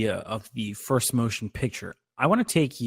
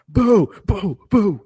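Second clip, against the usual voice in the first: loud, high string of boos; imitated booing; 9 semitones higher, 7 dB louder, slower than usual. Imagining people who waited to boo, loud high mock boos. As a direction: heckle with repeated punchy boos. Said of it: "I feel like with a boo".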